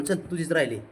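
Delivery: ordinary talk, nothing marked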